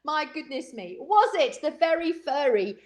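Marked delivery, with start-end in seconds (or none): none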